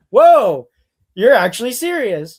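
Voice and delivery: loud, high startled whoa; mock astonished official; surprised. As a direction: caught off guard, half laughing. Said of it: "he's like".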